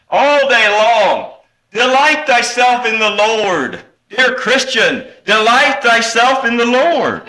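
high, loud, sustained pulpit call; preaching exhortation. Acted. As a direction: ring it out over the congregation, drawn-out. Exhorts congregation at full force.

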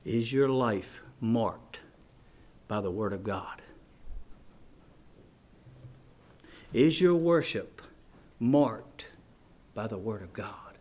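very low, soft, solemn appeal; quiet pulpit intensity with long pauses; hushed preaching. Real, not acted. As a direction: drop right down and question them gravely.

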